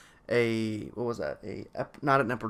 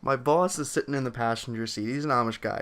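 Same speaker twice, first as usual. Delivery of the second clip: usual speaking voice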